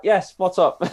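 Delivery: high, loud, bright "Yes, what's up?"; chirpy reply. Retold or imitated. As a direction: answer the crowd eagerly. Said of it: "and you're like".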